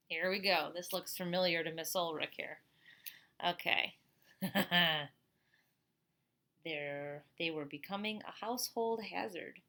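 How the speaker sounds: usual speaking voice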